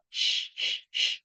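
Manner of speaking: a soft demo hiss of the "sh" sound; whispered hiss showing the sound. Shown as a demonstration. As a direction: let a little hiss escape, sh sh sh. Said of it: "ada desis sedikit keluar".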